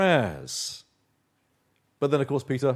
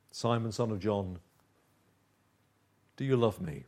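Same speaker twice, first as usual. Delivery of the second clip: low, soft, tender question; gentle; 4 semitones lower, 5 dB quieter than usual. Acted. Jesus restoring Peter after his denial, gentle and low. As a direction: ask it gently, leaving a long pause. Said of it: "It's gentle, isn't it?".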